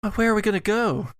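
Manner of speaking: a quick high panicked question; Allison, high and fast. Acted. Allison asks the group in a rush, high and fast. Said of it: "says Allison".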